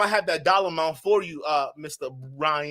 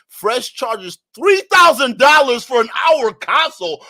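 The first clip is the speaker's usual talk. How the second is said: louder, higher disbelieving shout; shocked shout of the price; outraged shout; 6 semitones higher, 10 dB louder than usual. Real, not acted. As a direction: blurt it out in shock. Reading shocking price aloud.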